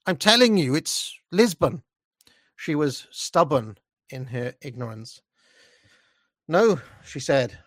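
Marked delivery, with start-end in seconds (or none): 0.1-1.8 exasperated insistence. Acted. Insisting in acted argument. 6.5-6.8 put-on higher, louder old-woman refusal; a hint of a stubborn old woman; working-class old woman. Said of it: "horrible working-class old woman way".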